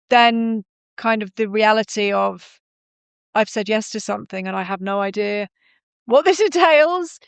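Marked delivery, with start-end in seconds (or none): 6.1-7.2 higher-pitched, laughing through the words; amused disbelief. Real, not acted. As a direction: laugh at your own cluelessness as you say it. Laughing at her own cluelessness.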